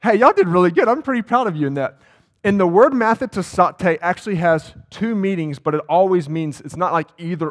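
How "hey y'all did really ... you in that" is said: higher and much louder, beaming at the crowd; bright burst of praise to the congregation; delighted praise. Real, not acted. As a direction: cheer them on for getting it right.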